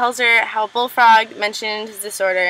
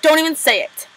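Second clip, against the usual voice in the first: higher, warning quote; mock-irritated, pitched up; retold irritated retort; 6 semitones higher than usual. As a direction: imitate someone cutting off an accusation. Acted retort in retold quarrel.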